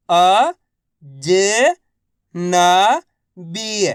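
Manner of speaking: राजनीतिक drawn out one syllable at a time. Shown as a demonstration. Teacher sounding out राजनीतिक for learners. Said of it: "तो मिलके बन जाएगा".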